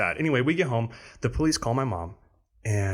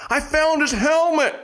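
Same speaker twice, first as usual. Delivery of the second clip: high, louder, slow mock yell; retold high yell of a middle-aged man; 7 dB louder than usual. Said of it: "you hear him yell".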